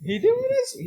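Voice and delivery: surprised. Real, not acted. Surprised at friend attending church.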